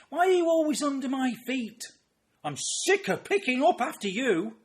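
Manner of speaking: very high, loud imitated scolding; shrieked exasperation at a husband underfoot; nagging wife. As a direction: yell like a fed-up wife. Wife scolding retired husband, acted.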